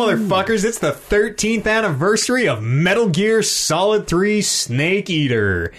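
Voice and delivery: high, drawn-out headline announcement; hyped news announcer. Pretend. Podcast host opening the news segment, hyped announcer delivery.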